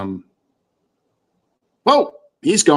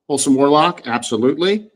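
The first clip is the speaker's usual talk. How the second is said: ordinary talk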